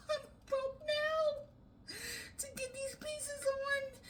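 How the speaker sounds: high, tearful laughing whine; half laughing, half crying over it. Real, not acted. Laughing-crying over broken nail.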